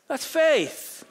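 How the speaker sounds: preacher's ringing exclamation; loud declaration. Real, not acted. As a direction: proclaim faith at the top of your voice. Preacher's climax punch line.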